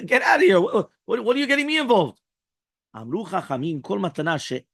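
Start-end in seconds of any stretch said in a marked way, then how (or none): 0.1-2.2 loud, high, incredulous get out of here; exasperated refusal. Acted. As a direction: push him away, disbelieving and loud. Friend refuses the kombina.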